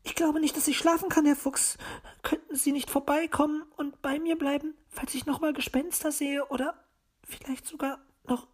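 fearful, hesitant high bird lady; halting. A narrator's reading. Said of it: "fragte sie stockend".

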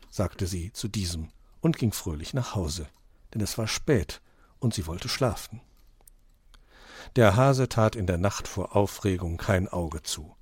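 ordinary delivery, nothing marked

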